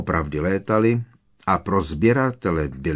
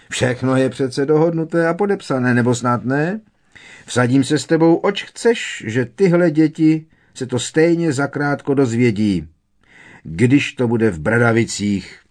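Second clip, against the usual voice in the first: higher, louder, boisterous character voice; jovial Pitloun, insistent; 6 semitones higher, 6 dB louder than usual. A narrator's reading. Pitloun bets Barty the children will find out, breezily insistent. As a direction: brush objections aside with gusto.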